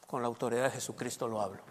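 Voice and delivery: hushed, low faith declaration; soft affirming prayer; quiet confession. Shown as a demonstration. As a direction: murmur it with calm certainty. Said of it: "Y dices".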